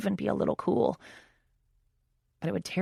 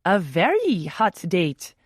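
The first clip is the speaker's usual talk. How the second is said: slow, hammed-up French accent; dramatic French accent; 2 semitones higher, 7 dB louder, slower than usual. A narrator's reading. Teasing Oliver about his date, in a dramatic French accent. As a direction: roll it out in a mock French accent. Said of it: "adding in a dramatic French accent".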